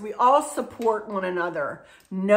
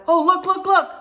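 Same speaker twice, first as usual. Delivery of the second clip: high, louder call to look; urgent excited call, pitched up; retold excited call; 10 semitones higher, 5 dB louder than usual. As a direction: imitate someone calling everyone over.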